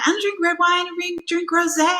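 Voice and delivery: high-pitched fussy guest imitation; mock complaint. Guest rejects the red wine she brought, mock indignant. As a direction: protest like a guest impossible to please. Acting out another displeased guest.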